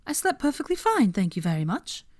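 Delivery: high, prim denial; defensive Hermione. Acted. Hermione insisting to Draco she slept fine, high and prim. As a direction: bristle as you insist you slept.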